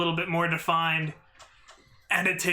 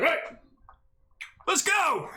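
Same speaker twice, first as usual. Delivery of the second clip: barked command; 12 semitones higher than usual. Acted. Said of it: "the shifter just barks at them".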